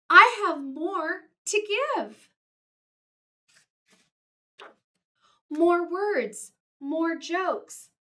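high, loud, urgent complaint; frantic plea. Acted. Gerald worrying to Piggy, frantic plea. Gerald frets the book will end.

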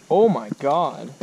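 ordinary talk, nothing marked

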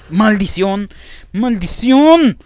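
real exasperated outburst, second word stretched; frustrated cursing. Real, not acted. Gamer curses a failed play, frustrated cry. Cursing at his failure in game.

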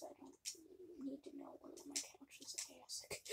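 hushed whisper aside. Real, not acted. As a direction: whisper it under your breath.

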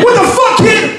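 high-pitched startled yelp; freaked out. Acted. Homeowner to crying boy as creepy violin plays, high freaked-out yelp. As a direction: recoil in fright, voice jumping way up.

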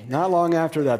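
ordinary talk, nothing marked

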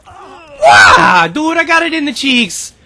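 high yell on getting hit, easing off; pained outcry. Real, not acted. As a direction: cry out as if just shot. Cries out on getting shot.

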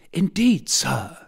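put-on a boy begging his guardian; passionate plea. A narrator's reading. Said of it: "very passionately begging".

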